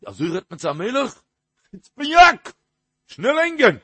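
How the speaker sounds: high, loud outburst from an angry king; acted royal rage, pitched high and loud; enraged king. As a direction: shout the order like a furious monarch. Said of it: "די, די מלך געווארן אין כעס".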